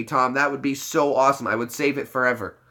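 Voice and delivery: ordinary talk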